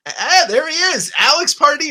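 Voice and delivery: high, loud delighted greeting; excited welcome. Real, not acted. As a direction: cheer the guest in. Host greets arriving guest.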